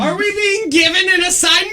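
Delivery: high, eager mock question; mock excited. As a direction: act thrilled about a mission.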